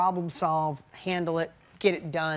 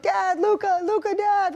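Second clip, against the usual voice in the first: much higher, loud back-and-forth yell; joyful greeting shout; 13 semitones higher, 5 dB louder, slower than usual. Retold or imitated. Father and son greeting each other, excited yelling. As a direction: call out both names with glee. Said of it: "And we're like".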